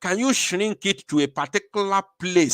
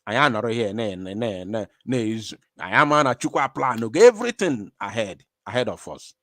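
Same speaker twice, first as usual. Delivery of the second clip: ordinary talk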